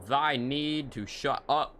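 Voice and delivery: lofty put-down in fake olde English; mock olde English. Gamer imagining a Shakespearean put-down, slow and lofty. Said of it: "you're walking around".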